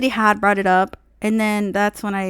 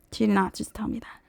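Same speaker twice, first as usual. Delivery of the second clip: whispered in disbelief, fast; shocked whisper; 9 dB quieter, faster than usual. Real, not acted. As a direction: breathe it out like you can't believe it. Disbelief at dealer's lowball offer.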